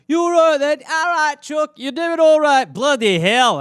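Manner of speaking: put-on high, loud English accent; mock English accent. American imitating his relatives in England, exaggerated English accent. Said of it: "when I go to England, they're all like".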